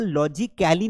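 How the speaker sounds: "लॉजिकली" as lo-ji-KAI-li; wrong pronunciation demo. Shown as a demonstration. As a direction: open "kae" vowel in "लॉजिकली". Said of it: "लॉजिकली नहीं बोलते". ("लॉजिकली" में "कै" की आवाज़)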